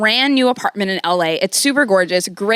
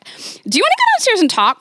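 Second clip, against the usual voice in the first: put-on high sweet girl voice; 11 semitones higher than usual. The girlfriend, much higher.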